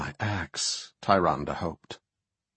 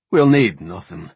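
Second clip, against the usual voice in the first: loud curt refusal; firm dwarf; 8 dB louder than usual. A narrator's reading. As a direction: shut it down, louder. Dour dwarf refuses hospitality.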